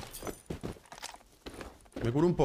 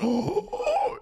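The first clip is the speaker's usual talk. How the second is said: high, strained shocked oh; shocked cry; 13 semitones higher than usual. Real, not acted. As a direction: cry out in disbelief. Shocked reaction.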